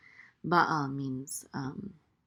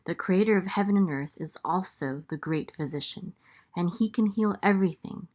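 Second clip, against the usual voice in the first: upbeat, louder closing ad read; promo read; 3 semitones higher, 7 dB louder than usual. Acted. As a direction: pitch the website to listeners.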